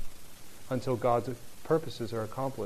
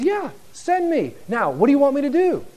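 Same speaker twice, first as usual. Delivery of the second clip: much higher, eager volunteer voice; eager Isaiah; 11 semitones higher, 8 dB louder than usual. Retold or imitated. Isaiah eagerly volunteering before God, high and bright. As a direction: bounce in, ready to go. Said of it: "He says".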